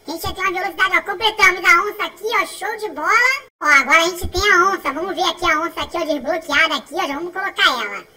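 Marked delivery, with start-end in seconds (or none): none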